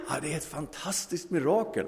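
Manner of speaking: high, bright-toned wonder; joyful answer. Acted. Hippie answering the theologian, delighted.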